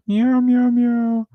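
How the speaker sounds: high mewing cat voice; cat meowing. Retold or imitated. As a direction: mew like a lonely cat. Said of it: "Rory was like".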